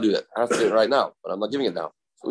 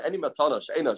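usual speaking voice

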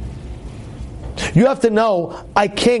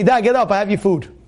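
blunt, quick demand to get up; brusque Esav manner. Acted. Esav rousing his father for food, brusque. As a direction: order dad up without any courtesy. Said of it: "let's say Esav would say".

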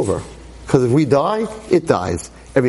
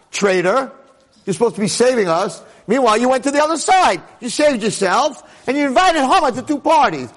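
mock accusing outburst; 8 semitones higher than usual. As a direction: hurl it at her like a betrayal. Accusing Esther as traitor.

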